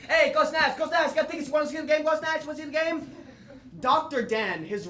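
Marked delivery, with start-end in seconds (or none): 0.1-3.1 high, loud gruff New York fan hawking tickets; [name]'s Knicks fan voice. Retold or imitated. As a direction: bark it like a ticket tout outside the Garden. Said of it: "and says".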